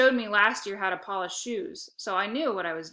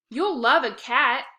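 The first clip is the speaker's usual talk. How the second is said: slow, loud, higher insistence; insisting; 5 semitones higher, 8 dB louder, slower than usual. A narrator's reading. Lexi insisting to Dad that he'll love a cat. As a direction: press the point hard. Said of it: "insisted Lexi".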